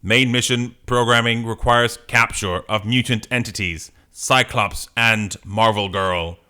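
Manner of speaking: measured mechanical announcement; robot voice. Acted. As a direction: recite it like a programmed machine. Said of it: "Are you gonna do a robot voice?".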